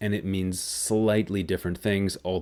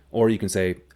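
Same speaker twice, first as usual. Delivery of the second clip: ordinary delivery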